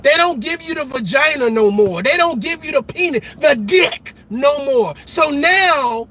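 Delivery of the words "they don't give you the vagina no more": ordinary delivery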